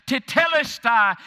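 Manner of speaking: a loud, high ringing cry of Tetelestai; shouted proclamation. Acted. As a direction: fling Tetelestai out as a triumphant shout. Shouted climax of sermon.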